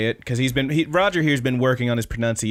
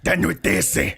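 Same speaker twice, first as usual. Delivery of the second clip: harsh mock German "De noitese", louder; 5 semitones higher, 4 dB louder, slower than usual. Showing cohost how German should sound, louder and harsh. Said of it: "But it's German, so you gotta be like".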